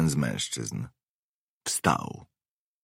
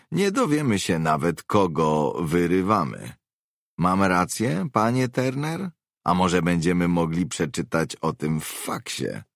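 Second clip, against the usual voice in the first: higher-pitched woman's voice, pointedly questioning; needling woman mercenary; 4 semitones higher, 3 dB louder than usual. Acted. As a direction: needle the boss with mock-polite questions, pitched up. Webber needles Turner about secrecy.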